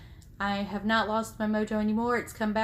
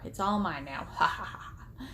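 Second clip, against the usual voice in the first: a playful evil gloat ending in a breathy cackle; mock villain gloat; 4 dB quieter than usual. Vlogger to viewers, gloating over the sewing room, mock evil cackle. Claiming daughter's space as hers.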